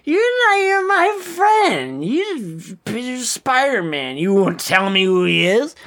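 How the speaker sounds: high, slurred mock accusation; hammed-up drunken anger at Peter; drunk angry Harry. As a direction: imitate a drunk friend lashing out. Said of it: "he is drunk and he's getting mad at Peter".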